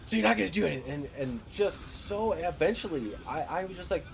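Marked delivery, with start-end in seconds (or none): 0.0-0.8 mock demanding customer. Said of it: "And she's like".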